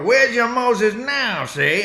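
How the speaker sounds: a hint of a tough-guy gangster drawl; slow, loud mock gangster; Edward G. Robinson gangster. Comic doing Billy Crystal's Edward G. bit, mild gangster voice. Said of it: "funny to see a guy go".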